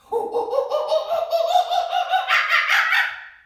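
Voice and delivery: high, loud screechy monkey laugh; crazy monkey laugh. Pretend. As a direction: cackle like a wild monkey. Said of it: "Can you be a crazy monkey?".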